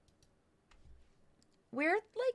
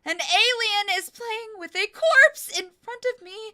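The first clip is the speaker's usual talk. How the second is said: loud high disbelieving shout; shocked yell; 9 semitones higher, 12 dB louder than usual. Acted. Repeats line as shocked yell.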